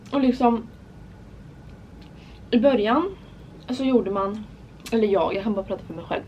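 ordinary talk, nothing marked

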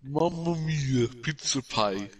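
slow hammed-up Italian accent. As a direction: drawl a mock Italian accent, stretching mamma mia. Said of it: "I'm the Italian?".

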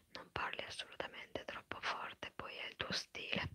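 ordinary talk, nothing marked

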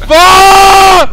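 loud, high drawn-out curse; real scare, high and loud; startled shout. Real, not acted. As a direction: yell it out in fright. Startled player in horror game.

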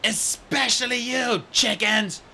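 Clay roaring for order; bellowing. Acted. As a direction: bellow over a noisy cave. Clay shouting over chaos.

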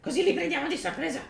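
high, excited goblin whispers; squeaky goblin lookout voice. Acted. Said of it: "non ho fatto la voce da goblin".

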